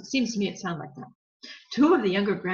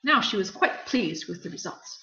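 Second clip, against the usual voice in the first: plain reading voice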